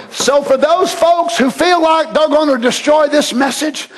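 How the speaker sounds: slow, shouted sermon climax; preaching at full force. Acted. Preacher defying those against the message, shouting.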